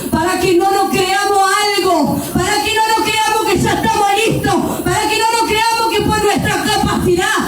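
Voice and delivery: high, shouted fervent preaching; preaching at full force. Acted. Preacher exhorting the congregation, fervent shout. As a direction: climb into a passionate shout to the church.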